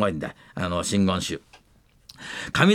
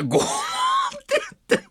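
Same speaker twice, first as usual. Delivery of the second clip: much higher, loud shouted bonk sound; much faster than usual. Retold or imitated. Acting the chairman's head hitting the glass, loud shouted bonk. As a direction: belt out the clang of a head on glass. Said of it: "ガラスに頭をつけて".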